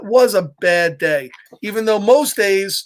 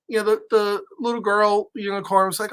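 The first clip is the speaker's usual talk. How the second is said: ordinary delivery, nothing marked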